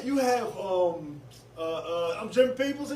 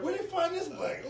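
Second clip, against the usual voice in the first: laughing; 14 semitones higher than usual. Retold or imitated.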